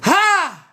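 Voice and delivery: a loud jeering bark of a laugh; mocking laugh. Real, not acted. Streamer laughing loud at a hater in chat.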